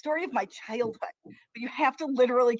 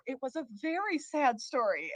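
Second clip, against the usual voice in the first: ordinary delivery, nothing marked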